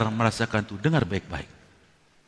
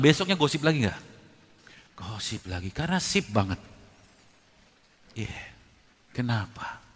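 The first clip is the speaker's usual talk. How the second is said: ordinary delivery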